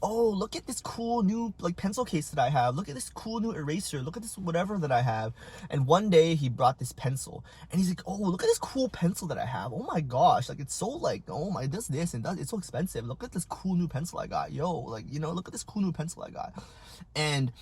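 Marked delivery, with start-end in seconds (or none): 0.0-5.3 higher-pitched show-off little kid, mock excited; bragging classmate put on in a raised voice; annoying kid. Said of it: "he was just really annoying". 8.1-16.5 show-off little kid, mock gushing; swooping, put-on boastful kid voice; annoying kid. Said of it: "he was just really annoying".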